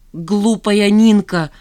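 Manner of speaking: loud, drawn-out exclamation; shouting. A narrator's reading. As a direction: cry out, stretching the words. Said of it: "закричала Жанна". (громкий протяжный крик)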